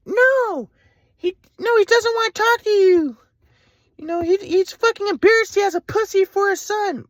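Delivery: high, slow sneering mother's voice; mocking mom. Mom insulting her teenage son, high sneering voice.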